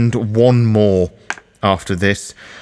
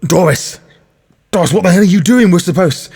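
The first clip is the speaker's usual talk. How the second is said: higher, louder cry of alarm; alarmed shout; 8 semitones higher, 5 dB louder than usual. Acted. Rochelle shouting at Doris to stop, alarmed. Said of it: "she's just gonna go".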